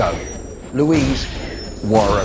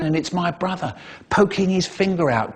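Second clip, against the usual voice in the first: ordinary talk, nothing marked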